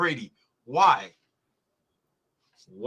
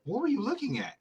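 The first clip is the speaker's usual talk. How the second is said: disbelieving question; 9 semitones higher than usual. Retold or imitated. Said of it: "I'm like".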